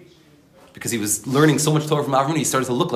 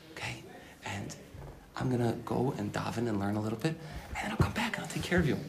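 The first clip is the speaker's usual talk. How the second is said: very soft, lulling reassurance; soothing a baby; 13 dB quieter than usual. Acted. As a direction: softly hush a fussing baby. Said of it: "treat the Yetzer Hara like a baby".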